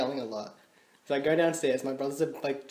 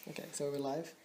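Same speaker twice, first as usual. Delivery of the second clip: whisper; 12 dB quieter than usual. Real, not acted. Whispering at start of recording.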